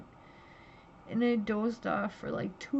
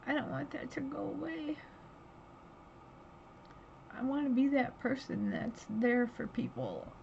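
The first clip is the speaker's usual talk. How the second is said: real emotion, voice pitched up and strained; holding back tears as she speaks; near tears; 4 semitones higher than usual. Real, not acted. Telling viewers about therapy, near real tears. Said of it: "I'm sorry, like I told you, that's one of those days".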